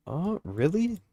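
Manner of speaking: rising, surprised really; genuinely surprised question. Real, not acted. Reacting to a viewer's comment.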